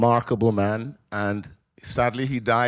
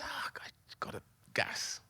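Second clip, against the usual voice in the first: breathless, barely voiced whisper; faint unvoiced gasp of words from a stricken father; weak whisper; 3 semitones lower, 18 dB quieter than usual. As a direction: push the words out on almost no breath, like a man in pain. Dying father answering weakly.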